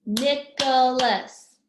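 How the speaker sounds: NICHOLAS in three loud, slow beats; syllable by syllable. Shown as a demonstration. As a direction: clap out NICH, O, LAS in NICHOLAS. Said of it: "has three syllables".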